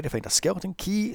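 normal talking voice

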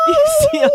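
high shrieking bird cry; scared bird squeal. Real, not acted. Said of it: "you sound like a scared bird".

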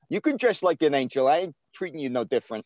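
put-on casual New York street-guy accent. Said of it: "you do a pretty good, uh, New York accent".

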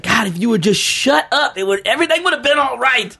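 high, exasperated inner plea; laughing through an imagined complaint; mock exasperation. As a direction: plead in amused frustration. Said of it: "you get a little frustrated".

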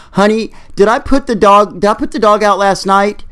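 half-awake wife asking; sleepy wife voice. Acted. Woman's first words, retold.

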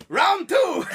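a big, high, laughing shout; excited shout. Pretend. Cohost jokes about a second French invasion, shouting gleefully. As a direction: yell it like a fight bell just rang. Joking the French invade again.

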